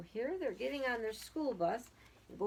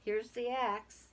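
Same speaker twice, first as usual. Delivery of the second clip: ordinary delivery, nothing marked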